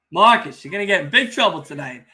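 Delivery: usual speaking voice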